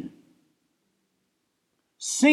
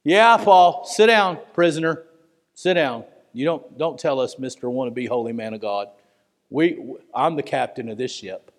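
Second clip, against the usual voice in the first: dismissive captain; 10 semitones lower than usual. Acted. Captain dismisses prisoner Paul.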